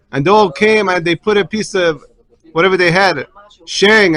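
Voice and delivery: usual speaking voice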